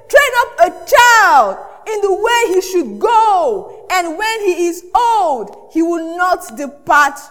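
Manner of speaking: slow, loud, deliberate Bible reading; scripture reading. Acted. Pastor reading Proverbs to the congregation, slow and loud. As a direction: proclaim each phrase slowly.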